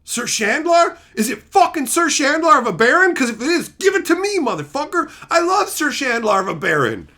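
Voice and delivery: hearing about his favorite card, excited shouting; excited outburst. Retold or imitated. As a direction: demand the card in delight. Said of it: "I'm like".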